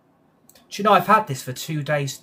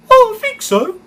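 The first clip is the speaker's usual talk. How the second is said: very high, loud squeaky cartoon voice; Lofty impression; 14 dB louder than usual. Streamer showing chat his Lofty voice, high and squeaky. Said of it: "There's my Lofty impression".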